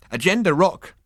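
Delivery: high, loud, cheerful trill; happy trill. Acted. Hairdresser chirping a silly pun, happily trilling. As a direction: sing it out like a pleased bird. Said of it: "trilled the hairdresser happily".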